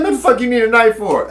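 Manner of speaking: mock a stunned older cellmate; incredulous cellmate. Cellmate baffled by knife request.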